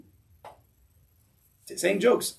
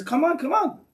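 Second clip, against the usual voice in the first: urging call; 12 semitones higher, 3 dB louder, slower than usual. Retold or imitated. Chazon Ish urging again.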